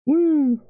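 very high, drawn-out "woo"; high whoop. Real, not acted. Streamer cheering that it's almost done, high whoop.

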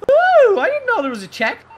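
high, loud surprised ooh; delighted little discovery; surprised squeal. Real, not acted. Streamer noticing a new game feature, surprised squeal.

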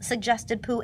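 ordinary delivery

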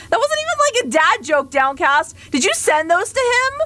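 high-pitched indignant questioning; incredulous accusation. Real, not acted. Streamer confronting Downcast about the jokes, incredulous. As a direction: squeak it out in disbelief.

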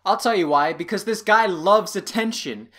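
ordinary delivery

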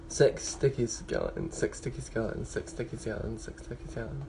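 repeated, fading to a whisper; fading tongue twister. Shown as a demonstration. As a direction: start normal, trail off to a whisper. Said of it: "Do twenty-seven".

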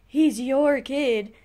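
slow, loud blaming parent; mock parent argument. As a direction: shove the blame, drawn out and loud. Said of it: "and, like".

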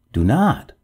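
urgent plea, jumping high and loud; alarmed, high-pitched protest; pleading cry. A narrator's reading. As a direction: beg them urgently to stop. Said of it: "cried the ghost".

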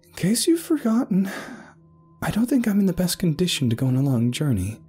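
high-pitched woman's voice, protesting; indignant objection. Acted. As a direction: remind them pointedly you are injured. Injured Ada objects to traveling.

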